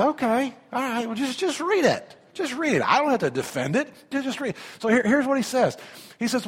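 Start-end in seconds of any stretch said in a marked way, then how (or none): 0.0-4.6 exasperated retort. Retold or imitated. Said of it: "I say".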